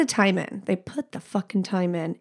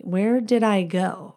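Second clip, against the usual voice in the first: usual speaking voice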